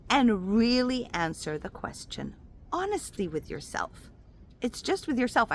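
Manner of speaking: normal talking voice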